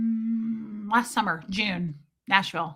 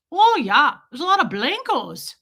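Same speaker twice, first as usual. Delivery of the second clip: higher, louder Midwestern lilt; Midwestern accent; 6 semitones higher, 6 dB louder than usual. Retold or imitated. Stranger remarks on fellow passengers, Midwestern accent. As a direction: imitate a chatty Midwesterner's accent. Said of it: "I usually mimic someone's accent".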